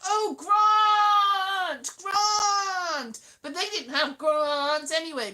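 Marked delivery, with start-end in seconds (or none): 0.1-3.2 high, loud posh "grant" with a long a; hammed-up posh girls saying "grant"; posh mock grant. Posh flatmates mocking her accent in a loud high voice. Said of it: "And they're like".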